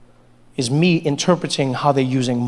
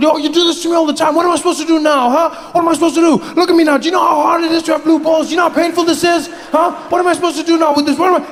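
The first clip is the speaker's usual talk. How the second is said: high, loud complaining yell; whiny outburst; 11 semitones higher, 3 dB louder, faster than usual. Acted. Boyfriend yelling at girlfriend about his frustration, high and loud. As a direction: shout and whine about blue balls.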